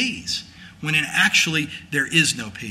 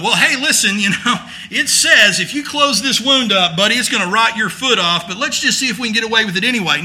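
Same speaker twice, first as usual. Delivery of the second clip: mock careless doctor; 7 semitones higher, 7 dB louder than usual. Mocking careless doctor quote.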